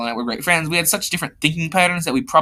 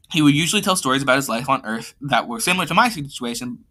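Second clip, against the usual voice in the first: plain reading voice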